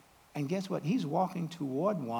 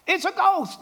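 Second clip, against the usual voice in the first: mock terrified cry; 13 semitones higher, 7 dB louder than usual. Terrified disciples quoted.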